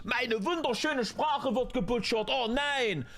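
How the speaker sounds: high, whiny lamenting voice; mock language purist. Streamer mocking complaints about butchered German, high mock lament. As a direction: wail in an exaggerated high pitch.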